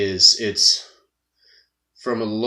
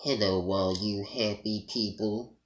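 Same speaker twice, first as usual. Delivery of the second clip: Droopy voice; 7 dB quieter than usual. Shown as a demonstration. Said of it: "I still have to pull on my cheek doing Droopy".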